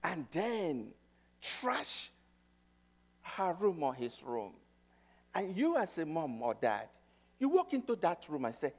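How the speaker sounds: normal talking voice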